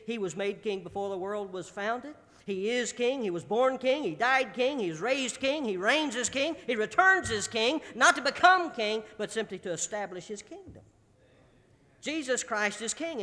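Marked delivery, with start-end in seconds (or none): 2.4-10.8 louder, higher, steady chant-like repetition; rhythmic preaching litany. Acted. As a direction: hammer each phrase like a drumbeat. Litany of kingship.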